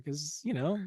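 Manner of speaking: ordinary talk, nothing marked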